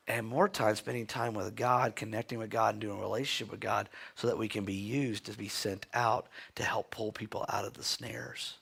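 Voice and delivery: usual speaking voice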